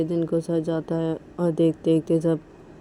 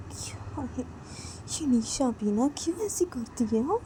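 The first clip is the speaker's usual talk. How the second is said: muttering to herself; 8 semitones higher, 3 dB quieter than usual. Acted. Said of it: "अपने आप बोलती कि".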